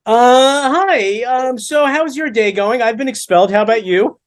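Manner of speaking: high, strained false nonchalance; mock casual confession. Expelled son calling Ma, awkward high small talk.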